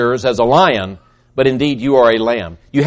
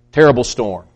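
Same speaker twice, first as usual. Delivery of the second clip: ordinary delivery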